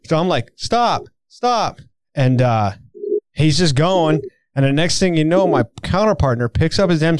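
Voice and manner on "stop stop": high-pitched mock order to halt; role-played guard command. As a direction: play along, ordering the car to halt. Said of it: "gonna role play too".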